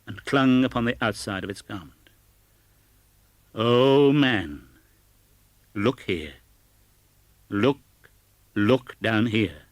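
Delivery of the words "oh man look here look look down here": louder, slowing on the last look; ghost exclaiming. A narrator's reading. Ghost forcing Scrooge to see the children, exclaiming. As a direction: urge him to look down. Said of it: "exclaimed the ghost".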